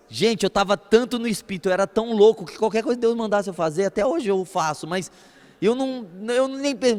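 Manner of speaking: usual speaking voice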